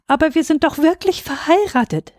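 high, pleading insistence; desperate protest. A narrator's reading. Husband insisting to doubting waiter, desperate. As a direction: protest in despair, pitch jumping up. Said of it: "rief Gerhard Grote verzweifelt".

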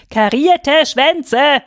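loud, high, slow bellow; shouted into his ear. A narrator's reading. Girls yelling into father's left ear, loud and slow. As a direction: yell it slowly right into his ear. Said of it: "brüllten wir ihm ins linke Ohr".

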